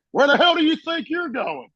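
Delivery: high-pitched mock holler; imitated sergeant's challenge. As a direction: holler after someone trying to leave. Gunny challenges fleeing Marine.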